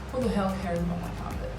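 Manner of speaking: normal talking voice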